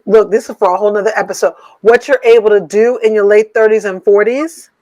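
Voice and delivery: normal talking voice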